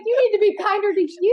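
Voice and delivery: high, bright delivery; high emphatic punchline. Retold or imitated. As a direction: land the twist brightly. Friend's punchline, pitched up.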